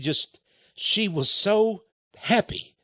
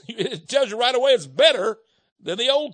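laughing; 6 semitones higher than usual. Real, not acted. Preacher amused at the obvious.

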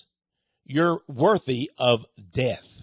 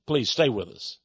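ordinary talk

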